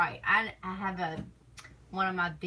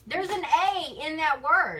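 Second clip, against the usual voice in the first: insistent; 10 semitones higher, 7 dB louder than usual. Real, not acted. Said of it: "arguing pronunciation with husband".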